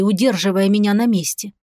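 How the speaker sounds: ordinary delivery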